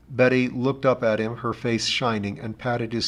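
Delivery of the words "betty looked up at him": ordinary delivery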